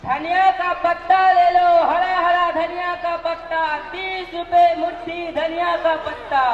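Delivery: high sing-song market cry; melodic vegetable vendor call. Acted. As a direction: hawk coriander to passersby. Vendor hawking coriander. (धनिया बेचने वाले की सुरीली पुकार)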